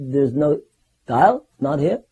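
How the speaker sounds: DIAL lifted in a rising question; brief puzzled query, rising sharply on "dial"; Prabhupada's questioning. Retold or imitated. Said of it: "how Prabhupada would question".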